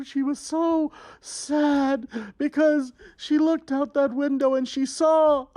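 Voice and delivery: hammed-up storyteller for a young audience; storytelling to children. A narrator's reading. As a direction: tell it like a melodramatic storybook. Reads fairy tale to kids.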